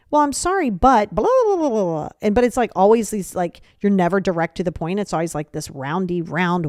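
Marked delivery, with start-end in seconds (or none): 0.1-2.1 high, loud fake apology running into babble; mock apology. Shown as a demonstration. Passive-aggressive person making an apology, mocking. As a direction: push "but" and tumble into blah-blah. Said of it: "You'll see this a lot in apologies".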